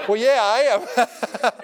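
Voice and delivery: slow, drawn-out laughing yes; laughing admission. Retold or imitated. Preacher admitting to lawyer he is cramped, laughing slowly. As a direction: drag it out through a laugh.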